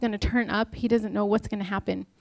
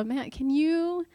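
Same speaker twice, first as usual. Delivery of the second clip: higher, drawn-out plea to husband; mock nagging request; 6 semitones higher, much slower than usual. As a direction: stretch it out like a wheedling wife. Mimics herself nagging her husband.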